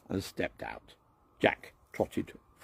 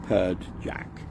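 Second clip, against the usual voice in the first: plain reading voice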